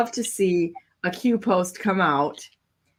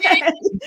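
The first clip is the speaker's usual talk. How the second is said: high burst of laughter; 11 semitones higher, 4 dB louder than usual. Real, not acted. Laughing while cheering.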